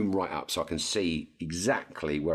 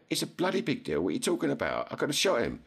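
indignant; 9 semitones higher, faster than usual. Retold or imitated. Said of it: "I went".

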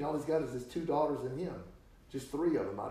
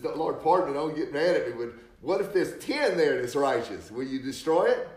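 louder, urgent begging of the Lord; pleading; 3 semitones higher, 8 dB louder than usual. Retold or imitated. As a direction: beg for mercy, pushing harder. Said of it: "Abraham begins to plead".

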